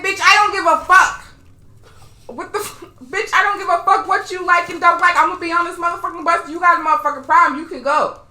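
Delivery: loud, high, defiant retort; angry comeback. Retold or imitated. Rider telling off the woman on the bus, angry and defiant. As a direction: retell telling someone off at full voice.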